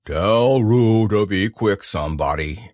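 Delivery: low, slow, mournful complaint; gloomy donkey. A narrator's reading. Eeyore with his tail in water, gloomy.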